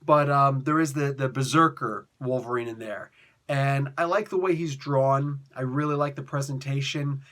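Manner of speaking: ordinary talk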